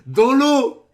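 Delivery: loud, high cry of disbelief; incredulous shout. Real, not acted. Reacting to underwater solve, incredulous shout. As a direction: blurt it loudly, stunned.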